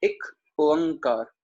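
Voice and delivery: एक ओंकार said slowly, syllable by syllable; broken into syllables. Shown as a demonstration. Teacher breaking the word apart for students, slow syllables. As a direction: split एक ओंकार into slow separate parts. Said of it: "मैं तोड़ के बोल रहा हूँ".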